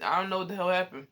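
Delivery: ordinary talk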